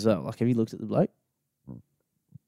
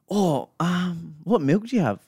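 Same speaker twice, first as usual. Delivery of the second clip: hell Aussie; 6 semitones higher, slower than usual. Retold or imitated. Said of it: "he was just hell Aussie".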